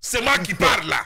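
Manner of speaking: angry teacher voice; stern scold. Acted. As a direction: cut the student off sharply.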